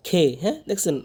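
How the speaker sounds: ordinary delivery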